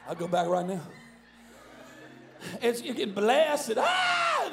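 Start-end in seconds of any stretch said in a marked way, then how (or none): 3.8-4.5 sudden high-pitched shriek; mock ecstatic scream. Imitating someone getting blessed, high shriek.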